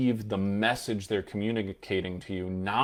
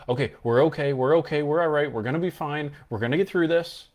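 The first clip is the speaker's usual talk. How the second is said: high, anxious reassuring voice; high, louder forced reassurance; unconvincing reassurance; 6 semitones higher, 5 dB louder than usual. Shown as a demonstration. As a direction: try too hard to sound okay. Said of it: "if you talk to yourself like that".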